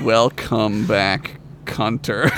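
low, very drawn-out delivery; slow mock announcement. Co-host twisting a sitcom title for laughs, slow low delivery. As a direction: draw each word out, dropping low.

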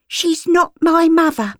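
put-on high, defiant girl; a child denying her keeper; street girl. Flora denies Gert is her mother, high.

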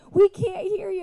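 high, loud call from afar; mock audience yell; imitated back-row shout. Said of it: "in the back, they're going".

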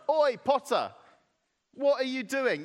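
high, loud mock protest; hammed-up cheeky objection; indignant challenge. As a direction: call out the potter in affront. Clay acted out challenging the potter.

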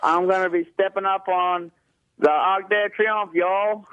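exaggerated slow, high Southern twang; southern accent. Shown as a demonstration. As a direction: stretch it out in a put-on Southern US accent. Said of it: "more southern accent".

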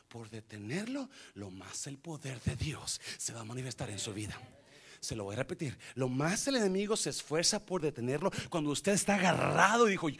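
normal talking voice

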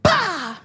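high, sudden "bah" for a slap; imitated slap sound. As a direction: voice the smack of a slap. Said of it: "gives me a dirty slap".

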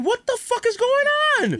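high-pitched comic outcry; retold alarmed disbelief. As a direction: cry out in mock panic, pitch way up. Retold alarm at his stomach.

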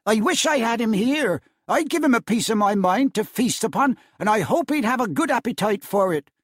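wife fuming about Scrooge at the Christmas table; indignant wife. A narrator's reading. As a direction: give him a piece of your mind. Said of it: "cried Missus Cratchit, reddening".

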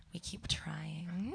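slow breathy whisper. Acted. Comic confiding to the crowd in a slow whisper. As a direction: whisper it like a secret.

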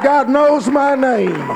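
loud, high, slow cry of joy; joyful shout. Retold or imitated. Man shouting his joy.

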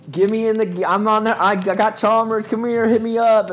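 high, loud, eager call; excited shout. Retold or imitated. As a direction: hype yourself up calling a teammate. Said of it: "I was like".

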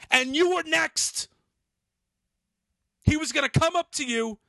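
worked-up retold telling-off; retold angry rant. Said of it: "I go".

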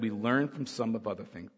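ordinary talk

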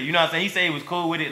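ordinary delivery

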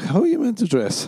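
high-pitched, incredulous retort; mock indignation. Man answering a girl who mocked his gym clothes, indignant.

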